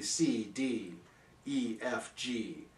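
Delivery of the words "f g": ordinary delivery, nothing marked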